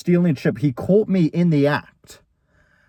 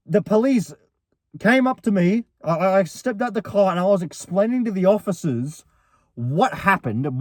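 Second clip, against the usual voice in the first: usual speaking voice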